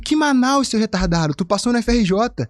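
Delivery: playful outrage; mock-scolding friend. Friend scolds him for going to Manaus, high teasing voice.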